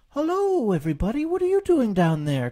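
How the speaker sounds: put-on high, loud little child calling down; young voice crying out. A narrator's reading. Roo calls down to friends in the hole, high and loud. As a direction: call down eagerly like a small child. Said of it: "cried a very young voice".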